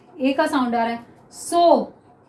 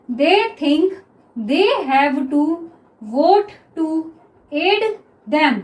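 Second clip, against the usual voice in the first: each word drawn out slowly; slow dictation; much slower than usual. Shown as a demonstration. Teacher dictates the next sentence to students, very slow. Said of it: "क्या हो गया ये".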